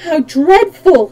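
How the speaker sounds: dismayed. A narrator's reading. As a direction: draw it out in horror. Dorothy horrified by the threat.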